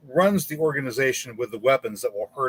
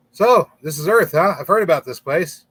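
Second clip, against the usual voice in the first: higher and louder, lightly voiced curious alien; curious alien visitor; 6 semitones higher, 8 dB louder than usual. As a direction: play a cheerful space visitor taking in Earth. Alien greets people on Earth.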